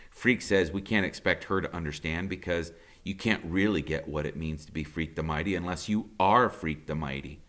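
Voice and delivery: ordinary delivery, nothing marked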